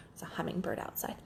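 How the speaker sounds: quick whisper; hush, point out the bird; whispered aside. Real, not acted. Whispering to viewers about a hummingbird outside. Noticing a bird mid-talk.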